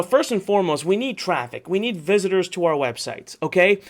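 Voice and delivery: usual speaking voice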